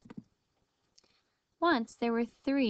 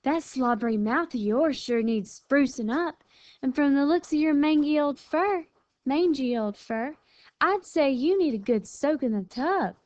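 louder, sassy fashion-queen voice; sassy Jilly; 5 semitones higher, 4 dB louder than usual. Acted. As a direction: scold his grooming. Jilly sassing coyote.

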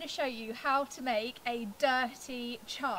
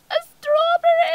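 excited; 10 dB louder than usual. Real, not acted. Presenting garnish with flourish.

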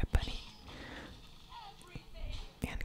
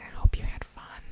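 usual speaking voice

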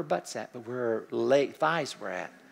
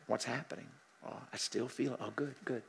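soft murmured check-in; 3 semitones lower, 11 dB quieter than usual. Shown as a demonstration. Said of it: "I wouldn't say very much".

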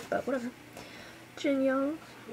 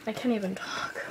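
ordinary delivery

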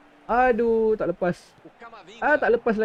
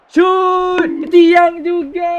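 high, loud, slow yell; frustrated shout; 10 semitones higher, 10 dB louder, much slower than usual. Real, not acted. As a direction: cry out as the shot hits the post. Own shot hits the post.

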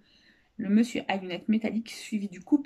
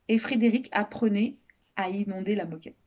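ordinary delivery

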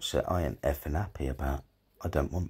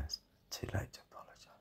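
whispered song line; 18 dB quieter, much faster than usual. Real, not acted. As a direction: whisper a lyric quickly.

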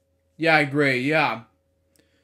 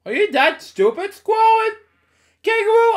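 high-pitched, loud disbelief; indignant outburst; 4 dB louder than usual. Real, not acted. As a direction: scold the kangaroo in high, incredulous outrage. Indignant outburst at story characters.

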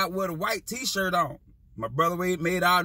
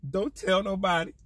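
usual speaking voice

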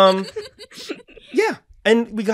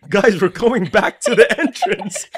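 slow, higher, louder imitation of a friend; Khalil; 7 semitones higher, 5 dB louder, slower than usual. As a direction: drawl out the complaint in a mock friend voice. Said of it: "I just kept hearing Khalil say".

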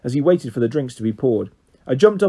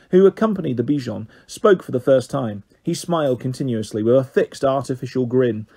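ordinary delivery, nothing marked

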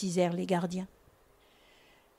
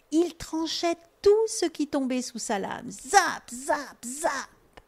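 lively; 7 semitones higher, 3 dB louder than usual. A narrator's reading. Narrator acts slicing sounds.

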